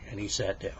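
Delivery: ordinary delivery, nothing marked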